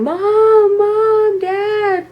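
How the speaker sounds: high, wailing call for parents; acted-out crying. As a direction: cry out like an upset kid running indoors. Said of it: "and I'm crying".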